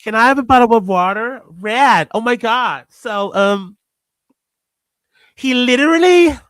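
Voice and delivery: high, girly American put-on voice; mock American accent gone very feminine. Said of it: "whenever I do an American accent, I, I, I turn into a woman".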